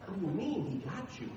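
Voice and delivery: soft, low, hushed disbelief; a worried kid muttering to himself; quietly aghast; alarmed thought. Retold or imitated. Little boy's alarmed thought.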